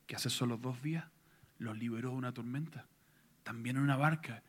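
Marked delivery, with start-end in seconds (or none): none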